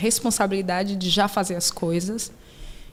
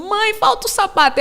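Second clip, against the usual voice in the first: put-on child calling out; 12 semitones higher, 4 dB louder than usual. Child calls from stairs.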